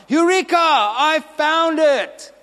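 high, loud, drawn-out cry of discovery; triumphant shout. Acted. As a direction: shout it out like a scientist who found it. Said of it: "and he shouts".

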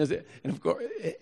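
ordinary talk

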